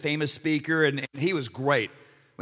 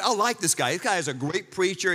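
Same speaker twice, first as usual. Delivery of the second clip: normal talking voice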